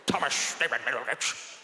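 put-on slurred old British headmaster; mutton-chopped old gent. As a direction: mumble the name through thick mutton chops. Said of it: "Like a guy with mutton chops".

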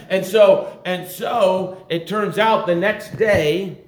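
high, loud pulpit exhortation; full-force preaching. Real, not acted. Preacher telling the congregation they share the same Holy Ghost, full-force preaching. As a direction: press it on the congregation at full volume.

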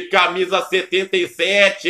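ordinary delivery

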